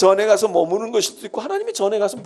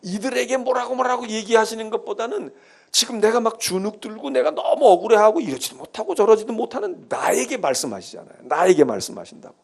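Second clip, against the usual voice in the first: usual speaking voice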